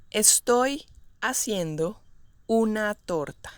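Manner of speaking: segmented example with clear pauses. Shown as a demonstration. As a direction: break the sentence up for learners. Teacher models example slowly, then naturally.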